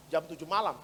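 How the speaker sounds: ordinary talk, nothing marked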